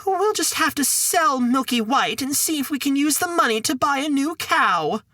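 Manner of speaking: the worried mum again; high-pitched mother. Acted.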